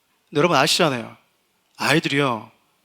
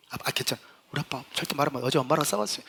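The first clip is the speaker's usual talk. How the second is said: 낮고 작게 비밀을 말하는 아이 흉내; 목소리를 낮춰 슬쩍 일러바치듯; 조용히 털어놓는 아이; 3 semitones lower, 10 dB quieter, faster than usual. Acted. 아이가 집안 비밀을 털어놓음. (소리 죽인 아이의 비밀 고백)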